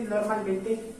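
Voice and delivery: ordinary delivery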